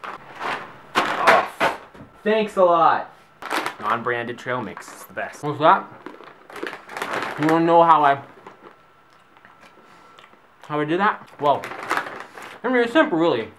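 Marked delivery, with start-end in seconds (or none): 0.0-3.1 sarcastic. Pretend. Clone sarcastic. 7.4-13.5 talking through a mouthful; chewing while talking; mouth full. Real, not acted. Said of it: "still talking with my mouth full".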